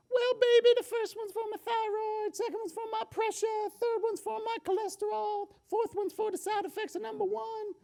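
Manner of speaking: put-on high, far Brooklyn grandmother voice in the Brooklyn accent. Grandmother explains her pills to her grandson in a high Brooklyn accent. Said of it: "she said in her Brooklyn accent".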